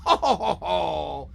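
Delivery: big fake laugh. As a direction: roar a theatrical laugh. Big laugh after joke.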